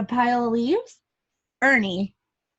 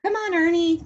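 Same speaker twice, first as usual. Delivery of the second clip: lightly raised, higher call to a friend; 6 semitones higher than usual. A narrator's reading. Bert calls Ernie to go home, lightly raised call. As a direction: call him over, mildly. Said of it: "called Bert".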